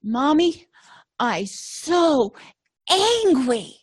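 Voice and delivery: high, loud little-kid outburst, imitating a two-year-old; toddler voice. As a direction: play the furious toddler loudly. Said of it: "he looks at me and he goes".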